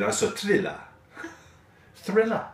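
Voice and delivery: imitating an Italian friend's accent. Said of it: "I can't do an Italian accent".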